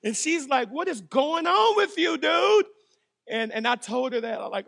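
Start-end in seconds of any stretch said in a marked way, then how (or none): 0.7-2.7 high, loud what's going on; wife's startled question. Retold or imitated. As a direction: voice the wife, surprised. Wife checking on crying husband.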